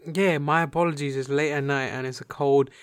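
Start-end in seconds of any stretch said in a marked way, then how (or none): none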